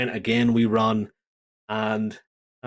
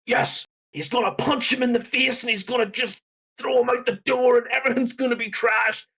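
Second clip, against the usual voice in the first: excited shout; 11 semitones higher than usual. Retold or imitated. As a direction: cheer it like a fan expecting a beatdown. Own hoped-for reaction, retold.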